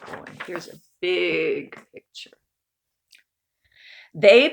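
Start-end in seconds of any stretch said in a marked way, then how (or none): 0.1-2.4 unvoiced, whispered aside. Real, not acted. Reader whispering an aside about the picture to kids. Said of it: "aside to kids".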